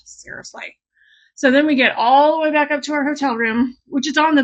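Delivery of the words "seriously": breathless, unvoiced mutter of disbelief; exasperated mutter. Beach trip cut short by a storm, she mutters in disbelief. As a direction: mutter it under your breath, fed up.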